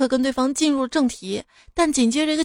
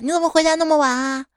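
higher-pitched nagging question; complaining wife; 4 semitones higher than usual. Acted. Wife to drunk husband home late, acted complaint. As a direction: nag him like an annoyed wife. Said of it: "老婆就抱怨".

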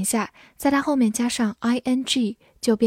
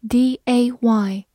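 spelling out; slower than usual. Shown as a demonstration. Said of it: "spelling the word".

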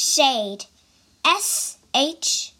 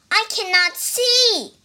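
higher, louder helpless cry; 9 semitones higher, 6 dB louder than usual. Acted. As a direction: cry out in distress. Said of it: "cried Toad".

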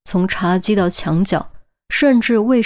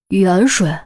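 startled. Acted. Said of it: "杜宾愕然".

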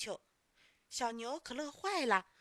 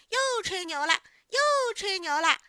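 high, loud mocking kids; scornful jeering; 9 semitones higher, 11 dB louder than usual. A narrator's reading. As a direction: jeer with a pouting sneer. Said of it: "小朋友们都撇着嘴说".

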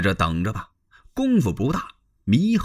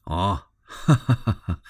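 慢悠悠的假笑; 笑里藏刀地干笑几声; fake laugh; 4 semitones lower than usual. 刘表假笑应付祢衡. (刘表对祢衡皮笑肉不笑)

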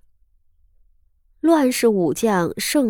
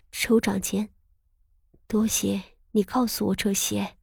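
softer, struggling words; strained reply; 6 dB quieter than usual. A narrator's reading. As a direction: force out thanks with difficulty. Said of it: "艰难道". (艰难地说)

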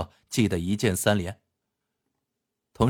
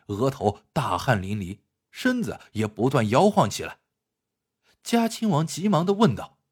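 higher, animated storyteller's voice; story narration; 4 semitones higher than usual. A narrator's reading. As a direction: narrate the tale with lively pitch swings. (评书式故事旁白)

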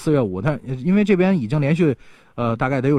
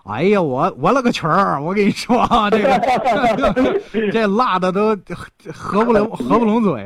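higher, excited mock complaint about heat; imitating spice-shocked visitor; 6 semitones higher than usual. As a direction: play a visitor gasping at the spice. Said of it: "一吃都是". (模仿被辣到的外地朋友)

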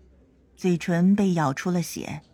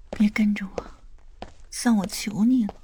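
weary; 4 dB quieter than usual. Acted. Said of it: "江盼月疲惫地说".

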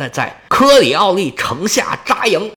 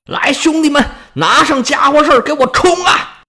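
high, urgent call to charge; rally the troops with an acted shout; rallying battle cry; 8 semitones higher than usual. A narrator's reading. General sends his brothers-in-arms charging, rallying battle cry. General rallies men to attack.

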